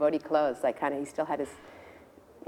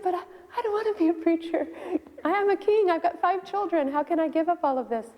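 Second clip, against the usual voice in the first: high-pitched, pleading objection; dismayed protest; 12 semitones higher than usual. Retold or imitated. King objecting to his guru about preaching, dismayed.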